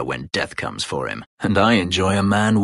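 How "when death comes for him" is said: quiet low menace at the end of the line. Acted. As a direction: drop low and soft on the threat. Threat against a wealthy mage.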